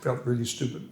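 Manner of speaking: normal talking voice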